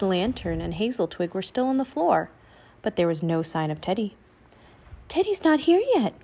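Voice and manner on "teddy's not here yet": high, lightly voiced young raven; a hint of a croak, pitched well up. A narrator's reading. Raven Annie reporting to Jack, high and lightly croaked. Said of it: "croaked Annie".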